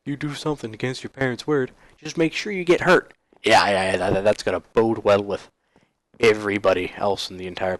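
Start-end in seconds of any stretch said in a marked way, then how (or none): 0.0-3.1 mock parent voice. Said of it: "It's sort of like".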